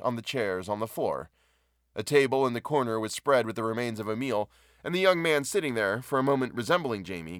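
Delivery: plain reading voice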